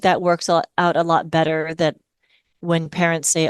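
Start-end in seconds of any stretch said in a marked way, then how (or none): none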